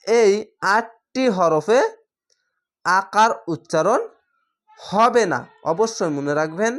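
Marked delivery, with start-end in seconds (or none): 0.1-5.4 slow, deliberate teaching; measured, spaced-out emphasis; emphatic rule. Real, not acted. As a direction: drive the rule home slowly, phrase by phrase. Teacher stresses the key rule.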